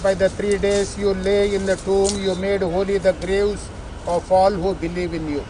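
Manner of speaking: ordinary talk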